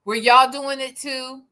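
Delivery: normal talking voice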